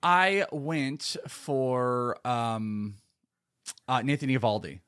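very slow, stretched-out words; hesitant, drawn-out announcement; slow, halting delivery. Real, not acted. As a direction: drag each word out while still deciding. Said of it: "thought you were doing your Christopher Walken imitation".

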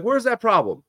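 high, puzzled mock voice; mock searching. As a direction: pretend to look around for the problem. Mock quote of onlookers.

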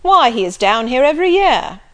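loud, bluff country squire; jovial loud old gentleman; hearty Sir John. Acted. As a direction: boom it out like a jolly squire.

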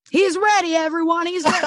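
chirpy, upbeat announcement; high cheerful announcement. Retold or imitated. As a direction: announce him proudly to everyone. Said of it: "I'm like".

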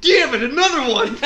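high, loud laughing grumble; mock weary killer. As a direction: groan like a tired worker. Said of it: "He's like".

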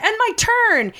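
high, hammed-up retort; indignant protest. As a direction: imitate her complaining in a high voice. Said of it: "she's like".

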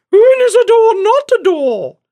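very high, loud, slow crone riddle; hammed-up eerie hag; eerie old crone. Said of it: "an old crone with an eerie accent".

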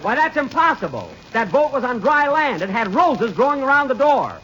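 indignant disbelief. Acted. Boss protests in disbelief.

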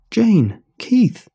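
loud high calling out names. A narrator's reading. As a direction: shout their names across the room. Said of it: "I called out".

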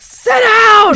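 high, full-out shout; long, loud exasperated holler; furious heckle. Acted. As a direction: yell at the TV in outrage. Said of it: "And I'd be like".

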